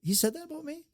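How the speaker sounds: high, incredulous gasp of a question; surprised disbelief. Retold or imitated. Person finding out about praise, high astonished disbelief. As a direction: act stunned to hear the praise. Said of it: "And when they find out".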